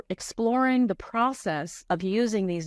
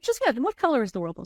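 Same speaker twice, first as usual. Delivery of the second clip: faster, higher and brighter repeat; repeating the question, a touch higher and quicker; insistent repeat; 6 semitones higher, faster than usual. Retold or imitated. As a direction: press the question again cheerfully. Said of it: "I was like".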